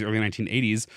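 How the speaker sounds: ordinary delivery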